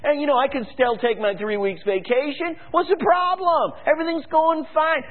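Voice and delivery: a smug satisfied citizen; complacent people mocked. Preacher mimics comfortable people ignoring warning, mocking high voice. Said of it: "The people said".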